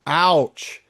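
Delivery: normal talking voice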